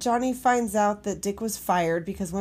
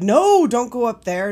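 urgent shouted warning; loud warning; 3 semitones higher, 8 dB louder than usual. Retold or imitated. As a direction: call out in alarm. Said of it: "he was like".

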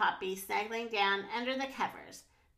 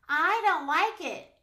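higher-pitched whiny moan; moaning complaint; 6 semitones higher, 6 dB louder than usual. A narrator's reading. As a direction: moan like a frightened little character. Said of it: "he moaned".

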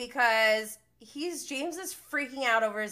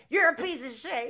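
higher-pitched mimicked insult; retold insult; 5 semitones higher, 4 dB louder than usual. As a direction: act out her outburst in the quarrel. Acts out the insult in a quarrel.

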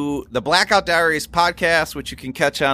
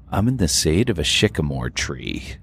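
NPR voice; 8 semitones lower than usual. Retold or imitated. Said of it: "that N P R voice they all use".

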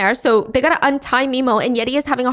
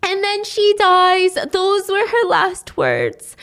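high, slow mock-tragic delivery; mournful narration; 8 semitones higher, slower than usual. A narrator's reading. Teller on grandma's last words, slow mournful narration. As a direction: draw it out high and grieving. Retelling the death after a breakdown.

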